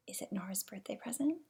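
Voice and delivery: hushed aside to the listener; whisper. Real, not acted. Reader whispering a guess to the child listener. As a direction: lean in and whisper it. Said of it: "reading aside to child".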